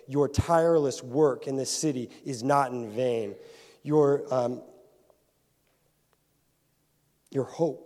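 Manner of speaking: loud, building sermon cadence; preaching at full force. Acted. Pastor rallies his church, preaching loud and building. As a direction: hammer each line like a preacher.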